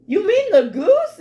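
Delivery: high, loud dawning question; sudden realization. Retold or imitated. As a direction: it finally clicks, loudly. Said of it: "he's like".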